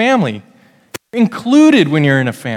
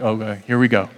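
put-on 1 resigned kid; 6 semitones lower, 6 dB quieter than usual. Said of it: "Sam's already smiling, like".

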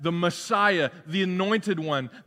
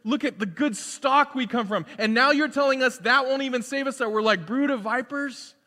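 raised, higher protesting voice of the crowd; indignant crowd; 8 semitones higher, 3 dB louder than usual. Acted. The crowd protests to John, higher and louder. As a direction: push back proudly, as the offended listeners. Crowd defending their Abraham lineage.

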